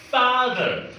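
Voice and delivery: normal talking voice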